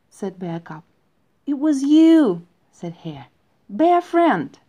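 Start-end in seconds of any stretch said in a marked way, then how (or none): 1.5-4.6 excited reveal. A narrator's reading. Hare reveals Bear Cub in dream.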